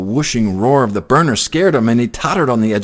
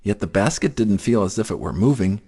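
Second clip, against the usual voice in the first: ordinary delivery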